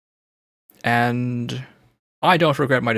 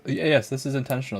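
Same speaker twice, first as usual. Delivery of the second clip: ordinary talk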